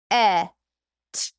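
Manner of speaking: each sound said alone; sounding out phonemes. Shown as a demonstration. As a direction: sound out "eh" then "t" one at a time.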